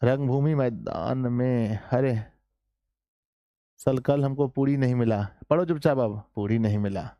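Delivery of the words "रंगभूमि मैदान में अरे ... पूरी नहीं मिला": normal talking voice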